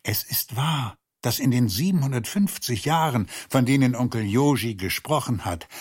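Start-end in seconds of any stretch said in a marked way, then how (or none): none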